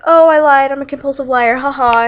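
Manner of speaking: high, loud start easing down; playful taunt with a fake haha; gleeful mock confession. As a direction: crow it like a gotcha. Voicing Allison's mocking confession.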